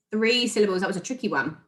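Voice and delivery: usual speaking voice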